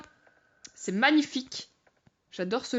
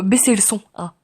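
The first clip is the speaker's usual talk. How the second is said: ordinary talk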